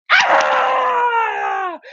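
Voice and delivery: high, long terrified shriek; mock scream. Mice look up at the cat above them, screaming. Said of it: "they look up and they go".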